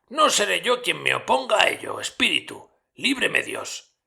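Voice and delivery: lento y algo más alto, ligeramente marcado; admítelo despacio, con pesar; Scrooge conmovido. A narrator's reading. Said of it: "contestó Scrooge". (Scrooge asiente al espectro, lento y grave)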